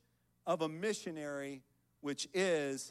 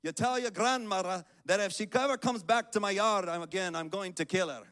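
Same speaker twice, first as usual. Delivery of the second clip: loud, higher-pitched mock Scottish brogue; Scottish accent; 3 semitones higher, 6 dB louder than usual. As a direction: give a defiant warning in your best Scottish accent. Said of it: "in my best Scottish accent".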